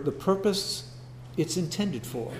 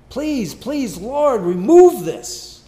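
higher, louder, slow desperate plea; earnest pleading; 7 semitones higher, 10 dB louder, much slower than usual. Acted. Paul begging the Lord to remove the thorn, pleading. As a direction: beg the Lord with everything. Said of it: "really earnest pleading".

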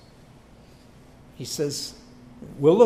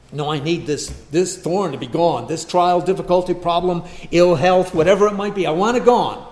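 louder and louder insistent demand; 8 dB louder than usual. Pretend. Believer insisting God remove the thorn, rising demand. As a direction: demand it be taken away.

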